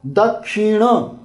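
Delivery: "Dakshina" slow, ending on retroflex na; drawn-out pronunciation. Shown as a demonstration. Teacher spelling out the word for south, drawn-out pronunciation. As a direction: say "Dakshina" stretched out, tongue curled back on n. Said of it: "This is no".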